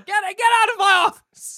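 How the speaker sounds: high furious editor shout; furious boss yelling. Pretend. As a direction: yell them out of your office.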